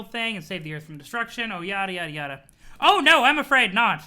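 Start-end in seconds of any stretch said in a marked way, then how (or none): 2.8-4.0 higher, much louder sprite. Acted. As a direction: cut John off, high and loud. Sprite contradicts John.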